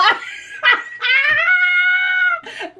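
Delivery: very high, long burst of laughter; high laughing shout, real; shrieking laughter. Real, not acted. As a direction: let a real squeal of laughter go. Explodes laughing.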